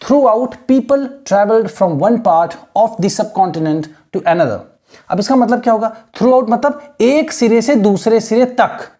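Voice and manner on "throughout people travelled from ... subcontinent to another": very slow, careful reading aloud; model reading. Shown as a demonstration. Teacher modelling how students should read the sentence, slow. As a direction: read it slowly, word by word. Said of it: "जैसे मैं read कर रहा हूँ".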